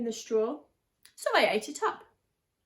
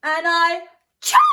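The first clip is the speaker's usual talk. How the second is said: building sneeze; 5 semitones higher, 12 dB louder than usual. Acted.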